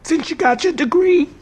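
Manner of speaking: high, loud mocking sass; sarcastic jab. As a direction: sneer at the doctor's know-it-all degree.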